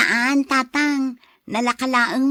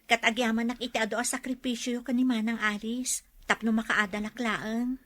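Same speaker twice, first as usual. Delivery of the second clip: softer, heartfelt gratitude; sincere thanks; 6 dB quieter than usual. Acted. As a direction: thank him quietly from the heart. Sister thanking brother for sacrifices. (ti ading nga agyaman iti manongna, naalumamay)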